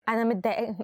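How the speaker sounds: ordinary talk, nothing marked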